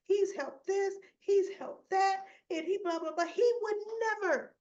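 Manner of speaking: high, whiny mocking imitation of a daughter; mock Christina. Recapping Christina defending her dad to Molly, high mocking whine.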